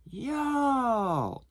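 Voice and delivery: high, drawn-out mock yell; imitated yell. Customer yelling for the clerk across the storage facility, mock yelling. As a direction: imitate a far-carrying "yo" without full volume. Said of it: "I heard him yelling".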